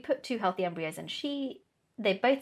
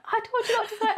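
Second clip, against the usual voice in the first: mock scolding. Retells Laura scolding her for swearing.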